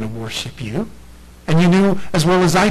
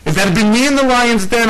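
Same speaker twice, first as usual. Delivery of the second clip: ordinary talk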